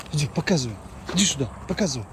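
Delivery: normal talking voice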